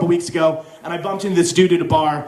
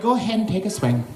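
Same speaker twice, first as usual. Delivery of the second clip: mournful put-on Irish accent; self-pitying Irish accent; depressing Irish accent. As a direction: play a defeated, woeful Irishman. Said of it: "If you go Irish, it's depressing".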